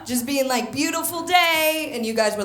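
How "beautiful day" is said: high, slow, cheery greeting; American enthusiasm. Retold or imitated. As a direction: imitate an over-happy American abroad. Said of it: "all my American happiness and enthusiasm".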